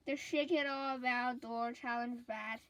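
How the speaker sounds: ordinary delivery, nothing marked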